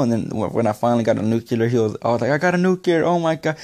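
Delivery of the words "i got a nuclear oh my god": toned-down replay of screaming; retold excited scream. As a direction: reenact a thrilled scream at moderate volume. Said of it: "I was screaming and stuff".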